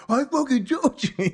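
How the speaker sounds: high-pitched mock triumphant crow; vindicated outburst. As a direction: act out a gleeful I-told-you-so. Acts out vindicated movie character.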